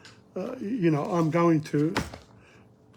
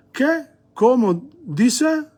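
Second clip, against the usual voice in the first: puzzled what; 7 semitones higher, slower than usual. Shown as a demonstration. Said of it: "modelling a puzzled question".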